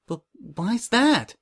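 high, slow, hesitant question; timid. A narrator's reading. Coco questioning Prim about the trophy, timid. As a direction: ask timidly, feeling your way into it. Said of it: "Coco asked timidly".

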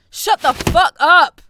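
loud, high, slow yell; furious shout. Acted. Captor screaming at the captive to shut up. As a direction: scream it in rage.

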